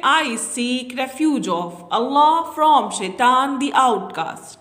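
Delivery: very slow, word by word; slow dictation. Shown as a demonstration. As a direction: say it slowly so children follow. Slow translation for children. (बच्चों के लिए धीरे-धीरे)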